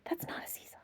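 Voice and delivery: unvoiced whisper; whispered warning. Monkey whispering a warning to the children. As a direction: breathe the words with no voice.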